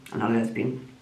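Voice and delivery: low, soft matter-of-fact correction; quiet dry rebuttal. Real, not acted. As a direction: drop the voice and set the record straight.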